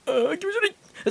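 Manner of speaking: high, soft cry of disgust; grossed-out squeal. Real, not acted. Reacts in disgust.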